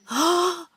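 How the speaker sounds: small high startled gasp; little cry. A narrator's reading. Aunt startled by the ghostly voice, little cry. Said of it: "giving a little cry".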